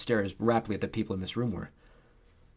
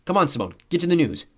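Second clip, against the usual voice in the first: loud, raised-pitch nagging; complaining; 9 semitones higher, 8 dB louder than usual. A narrator's reading. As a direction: complain impatiently to your co-host. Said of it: "Tucker complained".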